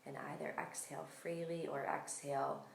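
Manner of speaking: calm, unhurried meditation voice with long pauses; guided meditation. Real, not acted. Yoga teacher settling listeners into breath awareness, calm guided meditation.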